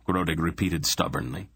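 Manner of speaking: ordinary delivery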